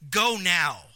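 "Go now" punched and loud, both words hit hard; an emphatic, urgent "Go now"; urgent command. Shown as a demonstration. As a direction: bark the order with emphasis. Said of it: "There's, there's an emphasis there".

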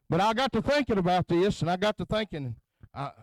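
ordinary talk, nothing marked

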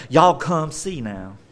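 slow Southern US drawl; Southern girl. Acted. Woman calls townspeople as a Southern girl, Southern drawl. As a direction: play up the Southern twang, drawn out. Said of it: "if she's a Southern girl, she'd be like".

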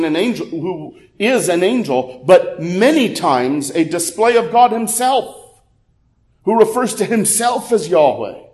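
usual speaking voice

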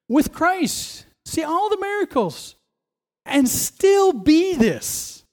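high, slow disbelief on and still be this; rising outrage at Judas; incredulous preaching. Real, not acted. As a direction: climb high and drag it out in disbelief. Preacher's indignant climax.

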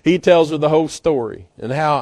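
ordinary talk

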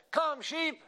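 high, strong imitated boy's holler; stronger boy's call. Eight-year-old brother calling sheep up the hillside, strong high yell. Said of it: "with his much stronger voice, he called up that hillside".

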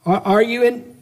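usual speaking voice

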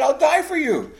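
high, loud vow of loyalty; emphatic protest. Retold or imitated. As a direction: swear it at the top of your voice. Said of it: "Peter's protesting emphatically".

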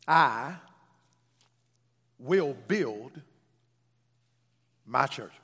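low, loud first word, long pauses; emphatic verse quote. Acted. Preacher quotes Jesus's statement to the congregation, slow and emphatic. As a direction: declare it in weighty, separate pieces.

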